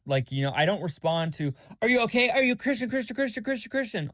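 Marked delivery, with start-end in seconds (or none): 1.8-4.1 very high, rattled-off mimicry of nagging; mock frantic pestering. Mimicking a friend who won't stop asking, mock frantic. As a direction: imitate someone badgering, name tumbling over name.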